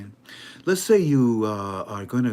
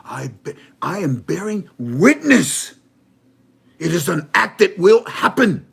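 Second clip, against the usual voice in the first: rising proclamation; 6 semitones higher, 10 dB louder than usual. Acted. Prime minister's rising proclamation.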